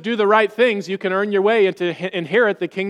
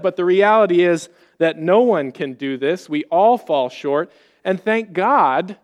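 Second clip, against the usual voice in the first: ordinary delivery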